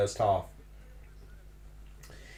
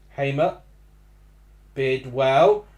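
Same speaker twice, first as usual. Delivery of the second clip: usual speaking voice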